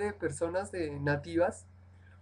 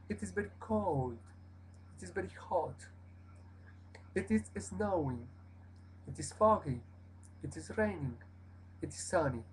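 softer model sentences, each falling at the close; falling sentence end; 8 dB quieter than usual. Shown as a demonstration. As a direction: drop the pitch on "cold", "hot", "snowing". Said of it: "bajar la acentuación al final de la frase".

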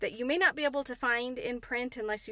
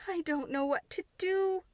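higher, fretful cry; agitated; 7 semitones higher than usual. Acted. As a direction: wring your hands in worry. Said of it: "cried agitated Martha".

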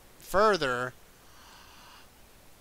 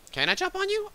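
put-on squeaky baby-talk question to a bug; cutesy high. Player coos at the ladybug, squeaky high. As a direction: coo it up high like talking to a pet.

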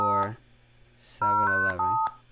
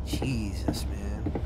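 whisper; 5 semitones lower, much slower than usual. Real, not acted. Alone in dark game.

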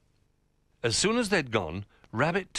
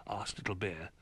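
usual speaking voice

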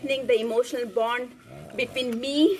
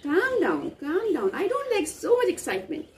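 high scolding; 8 semitones higher than usual. Real, not acted. Scolding dog again.

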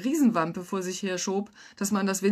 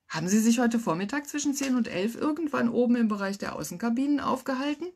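plain reading voice

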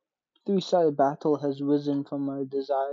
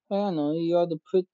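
ordinary delivery

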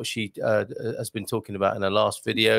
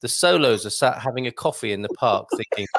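normal talking voice

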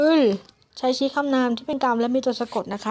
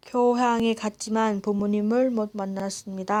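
native speed Korean; much faster than usual. Shown as a demonstration. Rereading Korean example sentence.

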